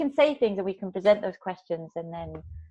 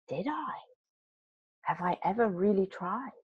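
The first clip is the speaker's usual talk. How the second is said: soft, musing self-doubt; thinking to oneself; 8 dB quieter than usual. Retold or imitated. Cyclist thinking on the way home, quiet self-questioning. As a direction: wonder softly, as an inner voice. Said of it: "I remember cycling home thinking".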